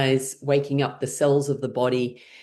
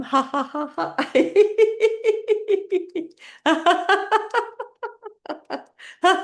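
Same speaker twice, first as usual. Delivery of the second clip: high, rapid staccato laugh; pitched-up exercise laugh; fake laughter. Shown as a demonstration. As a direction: force out rising ha-has while tapping. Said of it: "as you're making laughing sounds".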